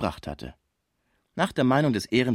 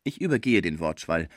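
plain reading voice

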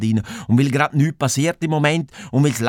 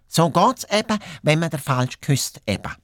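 higher-pitched, slightly cheeky hare; a bit cheeky; 6 semitones higher than usual. A narrator's reading. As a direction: say it with a touch of sauciness. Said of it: "sagt der Doppelhas ein bisschen frech". (ein bisschen frech, mit hoher Stimme)

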